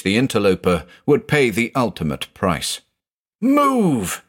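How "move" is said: sharp, much higher shouted command; Van Melson yelling at the others to flee; bellowed. A narrator's reading. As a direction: yell it as a warning. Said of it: "Van Melson bellowed".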